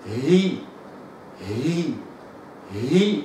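هي from a still h into i; soft repeated هي drill; kasra hi. Shown as a demonstration. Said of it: "هأكسر من وضع السكون ثم أكسر". (هي مكسورة من السكون)

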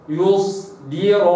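يصدر slow, thin dal after sad; tajweed recitation. Shown as a demonstration. Teacher models يصدر slowly. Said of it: "هكذا".

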